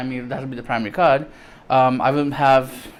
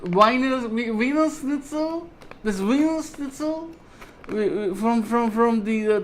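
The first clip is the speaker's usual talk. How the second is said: much higher mock German accent, hammed-up; a silly, squeaky German tourist bit; 7 semitones higher than usual. Shown as a demonstration. Vlogger hamming a squeaky German accent about Germany. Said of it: "I can't do my German accent".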